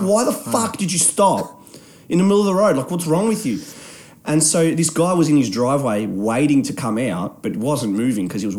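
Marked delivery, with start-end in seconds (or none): 0.0-3.6 high, retold angry challenge; exasperated demand. Driver asking why she braked, retold exasperation. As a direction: lean on it in disbelief. Retold argument after car bump.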